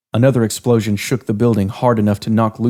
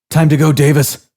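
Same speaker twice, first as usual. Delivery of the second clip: higher, louder urgent call; yelling; 5 semitones higher, 4 dB louder than usual. Acted. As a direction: rouse your exhausted friend. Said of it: "Freakshow yelled".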